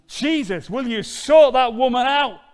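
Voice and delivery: very loud, high indignant demand; exasperated complaint. Retold or imitated. Martha complaining to Jesus about Mary, loud and indignant. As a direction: tell Jesus off about your sister. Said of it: "Martha looks round and says".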